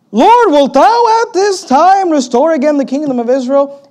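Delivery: high, loud, drawn-out hammed-up question; mock-eager plea. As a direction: call it out like an over-eager disciple. Acting disciples' eager question.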